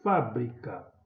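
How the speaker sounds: FÁ punched in Fábrica; stressed first syllable. Shown as a demonstration. Teacher showing pupils the tonic syllable, stressed FÁ in Fábrica. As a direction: hit FÁ in "Fábrica", then let the rest drop. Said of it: "é o fá".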